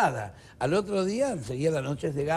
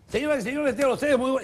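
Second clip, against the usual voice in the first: showman magician; 8 semitones higher, 3 dB louder, faster than usual. Acted. Joke's magician announces the show.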